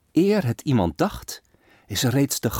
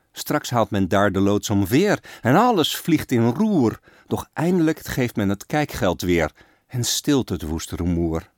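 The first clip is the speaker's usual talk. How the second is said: ordinary delivery, nothing marked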